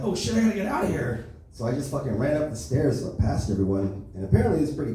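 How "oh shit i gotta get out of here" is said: high, rushed mock panic; hammed-up high-pitched alarm; panicked high voice. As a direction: squeak it out in a panic. Panicked escape on stairs.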